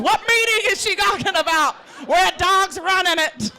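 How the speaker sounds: confused neighbor shout. Retold or imitated.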